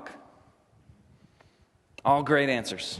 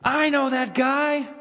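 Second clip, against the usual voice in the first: higher, slow dawning recognition; realizing Peter; 9 semitones higher than usual. Acted. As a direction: let it dawn on you. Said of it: "He says".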